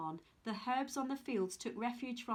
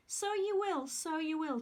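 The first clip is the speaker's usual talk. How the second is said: chuckling; 5 semitones higher than usual. Acted. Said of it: "chuckled the peculiar little old man".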